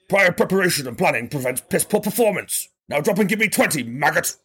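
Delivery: lightly barked drill sergeant order; a hint of a shouting army instructor. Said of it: "It's like Father always said".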